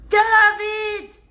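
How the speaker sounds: high, loud call out the window; a loud, high shout of David; screaming out. Retold or imitated. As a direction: yell to the neighbor across the way. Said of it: "She screams out".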